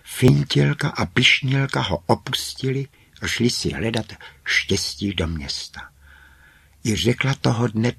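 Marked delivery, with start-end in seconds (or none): none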